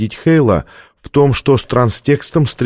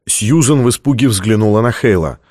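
ordinary delivery, nothing marked

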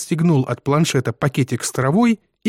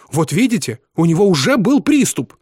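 high, loud, punched words; triumphantly indignant mother; indignant vindication; 6 semitones higher, 4 dB louder than usual. A narrator's reading. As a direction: throw it at them as proof. Mother seizes on proof.

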